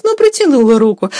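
ordinary delivery

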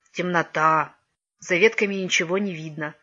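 usual speaking voice

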